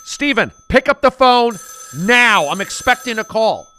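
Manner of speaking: high, loud mom shout; yelling mom. Acted. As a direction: bark the order across the house.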